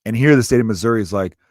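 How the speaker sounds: normal talking voice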